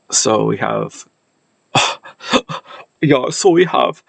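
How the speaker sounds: a stray German accent on plain words; slipped German accent. Real, not acted. Linguist reading notes, slips into a German accent. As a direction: slide into a German accent without meaning to. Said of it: "it went into a German accent there".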